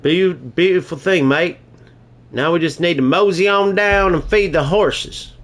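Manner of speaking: put-on a playful British cowboy accent; English-Texan mix accent. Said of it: "this is my English slash British mix accent".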